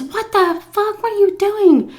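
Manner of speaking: high, loud mock of a panicked male friend; retelling the friend pulling her from the club, high and shocked; alarmed friend. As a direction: squeal it like a friend dragging you out. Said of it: "He goes".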